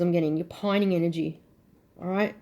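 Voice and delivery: ordinary talk, nothing marked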